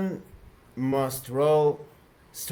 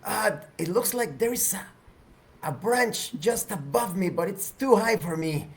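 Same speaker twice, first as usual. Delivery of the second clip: higher-pitched character voice; Rao; 6 semitones higher than usual. Acted. Stuck character reports branch out of reach, higher voice. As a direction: raise the pitch, halting between phrases. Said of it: "Rouse claims".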